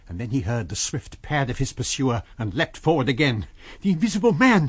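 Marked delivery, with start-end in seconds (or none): 3.8-4.7 high, loud alarmed cry. A narrator's reading. Kemp warning the navvies, a loud cry. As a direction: shout a warning to the workmen. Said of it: "he cried to the navvies".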